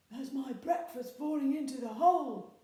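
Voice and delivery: a troll sure breakfast has arrived; gleeful troll. A narrator's reading. Troll hearing the bang, loud and gleeful. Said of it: "he thought".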